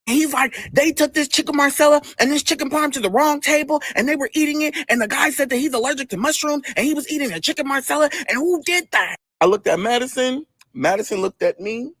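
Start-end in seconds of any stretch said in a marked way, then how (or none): 0.7-9.2 higher-pitched, run-on mock complaint; imitated upset complainer. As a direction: rattle off the grievance in a high put-on voice. Angry man's complaint acted out.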